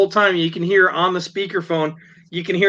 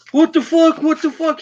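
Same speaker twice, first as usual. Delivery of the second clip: high, pitched-up mock swearing; mock rant; 10 semitones higher, 3 dB louder than usual. Driver who rammed the car, high imitated cursing. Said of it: "the guy's just like".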